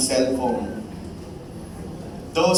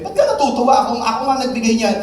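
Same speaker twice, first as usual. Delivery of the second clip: indignant disbelief; 8 semitones higher, 4 dB louder, much faster than usual. Acted. Atheist indignant question.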